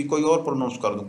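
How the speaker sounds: usual speaking voice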